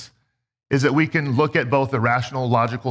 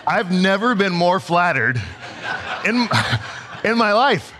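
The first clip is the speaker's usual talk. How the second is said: laughing; 4 semitones higher than usual. Real, not acted. Laughing at flattering stranger.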